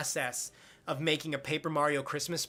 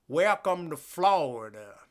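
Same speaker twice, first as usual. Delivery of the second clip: rich, drawn-out Southern drawl; slow Southern accent; slower than usual. Shown as a demonstration. Streamer demonstrating Florida Panhandle talk, slow Southern accent. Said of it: "rich Southern accents that's kinda slow like this".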